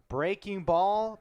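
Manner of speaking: excited pitch call. Real, not acted.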